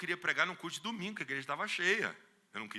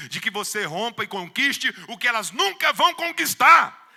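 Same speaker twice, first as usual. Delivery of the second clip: rising louder and higher to a climax; building preaching; 5 semitones higher, 11 dB louder than usual. Real, not acted. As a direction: preach it up to full force.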